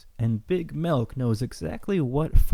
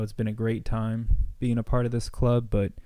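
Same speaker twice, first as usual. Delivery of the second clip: usual speaking voice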